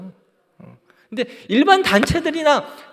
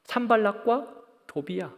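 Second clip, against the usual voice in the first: usual speaking voice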